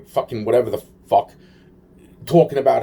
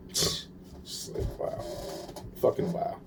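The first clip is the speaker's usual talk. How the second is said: whispered in disbelief, barely there; hushed, voiceless disbelief; stunned whisper; 14 dB quieter than usual. Real, not acted. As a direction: breathe the words out, floored. Stunned by same scene as his.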